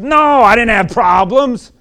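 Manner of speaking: put-on loud, high, slow bitter kid voice; bitter teenager. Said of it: "He said".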